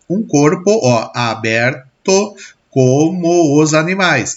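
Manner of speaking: slow, syllable-by-syllable verse reading; counting out syllables. Shown as a demonstration. Teacher showing students the metrical count, slow syllable-by-syllable scansion. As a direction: measure the line out slowly, drawing out each beat. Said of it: "Eu vou contando".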